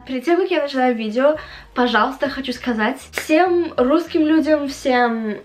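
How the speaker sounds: ordinary delivery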